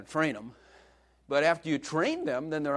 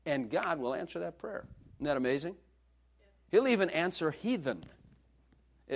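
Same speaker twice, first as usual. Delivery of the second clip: ordinary talk, nothing marked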